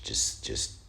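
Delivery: hushed whispered trail-off; a breathless whisper, picturing him staring. Acted. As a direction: let it fade into a whisper. Dramatic pause over the photo.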